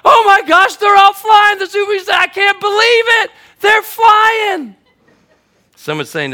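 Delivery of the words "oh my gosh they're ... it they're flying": very high, loud cries of disbelief; over-the-top acted amazement at the top of the voice; amazed shouting. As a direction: yell in hammed-up astonishment. Acting amazed onlookers at flying penguins.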